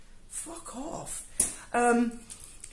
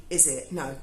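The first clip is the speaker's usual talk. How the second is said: ordinary delivery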